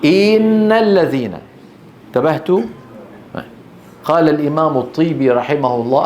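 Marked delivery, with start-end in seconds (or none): 0.0-1.3 إن said iin, i drawn out; mispronounced إن, long vowel; wrong stretched kasra. Shown as a demonstration. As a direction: stretch the i in إن toward a ya. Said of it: "إذا طولناها شو بتصير".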